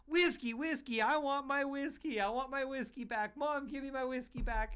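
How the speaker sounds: imitating a small boy begging his mom; whiny little kid. Dad imitating the neighbor kid begging his mom for whiskey, high whine. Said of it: "that little kid was running up".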